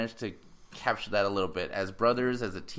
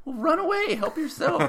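mock retort; 4 dB louder than usual. Retorting to the whiny girl.

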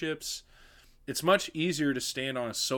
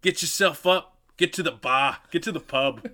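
louder mock Boston accent, broad open vowels; 2 semitones higher, 5 dB louder than usual. Shown as a demonstration. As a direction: order them about in a Boston accent. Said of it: "how to do a Boston accent".

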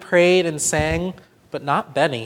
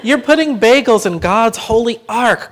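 loud, higher outcry; crying out; 6 semitones higher, 7 dB louder than usual. A narrator's reading. Grandpa crying out at bagels in the ark. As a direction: cry out in disbelief. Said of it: "cried Grandpa".

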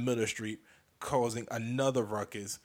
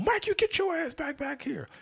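Stephanie scolding. Retold or imitated. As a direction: play the panicked girlfriend hauling him back. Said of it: "pulls me back in".

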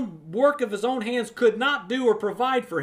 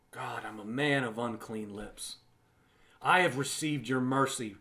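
humble prayer; 8 semitones lower, 8 dB quieter than usual. Acted. Humble prayer within sermon.

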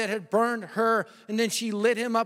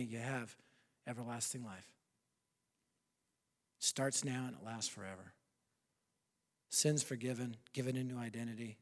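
much softer, lower closing invitation; quiet altar call; 7 semitones lower, 8 dB quieter than usual. Acted. Preacher offering eternal life to listeners, soft and intimate. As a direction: gently offer the gift, barely above a murmur.

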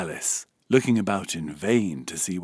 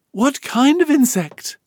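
high, louder, mildly anxious question; a little anxious; 9 dB louder than usual. A narrator's reading. Alice questioning the insect, high and mildly anxious. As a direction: ask with a touch of worry, pitched up. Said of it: "Alice inquired a little anxiously".

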